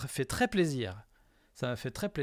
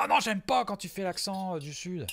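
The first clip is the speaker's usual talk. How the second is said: high-pitched imitation of protesting; mock complaint; 7 semitones higher, 3 dB louder than usual. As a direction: imitate her weekly objection, pitched up. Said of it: "elle me dit".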